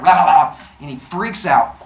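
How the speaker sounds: plain reading voice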